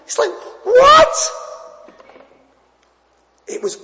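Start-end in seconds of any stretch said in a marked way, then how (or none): 0.1-1.1 incredulous. Real, not acted. Incredulous reaction to friend.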